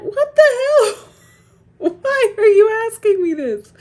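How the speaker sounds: very high, loud, drawn-out wail of disbelief; hammed-up shriek of exasperation; exasperated yell. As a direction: cry out in comic outrage at prying questions. Acted outburst at intrusive texts.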